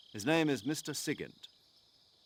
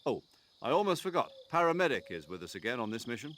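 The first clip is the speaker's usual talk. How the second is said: normal talking voice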